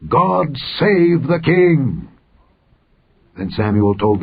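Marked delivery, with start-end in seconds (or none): 0.1-2.1 mild drawn-out cheer; crowd shout. A narrator's reading. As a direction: stretch it out high like a crowd hailing its king. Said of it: "all the people shouted".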